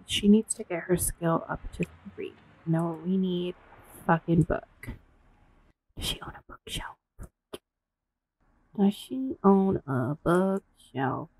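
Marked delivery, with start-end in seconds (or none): 6.0-7.0 fast whispered question. Real, not acted. Streamer muttering a question to herself, whispering fast. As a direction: whisper it quickly to yourself. Unvoiced quick aside.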